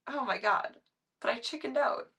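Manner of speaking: ordinary talk, nothing marked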